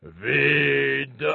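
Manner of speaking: drawn-out dragon verdict. A narrator's reading. Dragon inspects the knight's bucket, drawn-out dragon voice. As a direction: stretch the word like a gloating dragon. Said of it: "il se penchait au-dessus du seau et disait".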